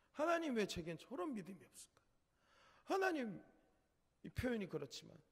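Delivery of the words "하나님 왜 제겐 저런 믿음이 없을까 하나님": high, pleading cry to heaven; crying out to God. Retold or imitated. Preacher crying out to God on the mountain, anguished. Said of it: "산에 가서 막 부르짖었어요".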